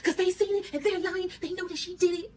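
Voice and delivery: high-pitched, loud mock outrage; super mad husband. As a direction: imitate him puffed up and protesting, voice shooting up. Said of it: "He gets super mad".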